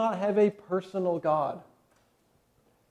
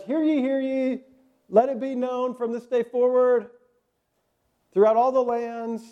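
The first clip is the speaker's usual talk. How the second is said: town crier; 5 semitones higher than usual. Retold or imitated. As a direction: announce it to the whole town. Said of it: "the town crier".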